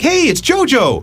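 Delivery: high, drawn-out call of recognition; excited greeting. Retold or imitated. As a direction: call out happily to an old friend from afar. Excited retold sighting of dolphin.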